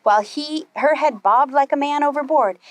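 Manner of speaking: ordinary delivery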